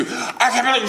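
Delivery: high, loud furious rant; screaming mom. Retold or imitated. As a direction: rant at him in a mock fury. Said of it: "She's screaming".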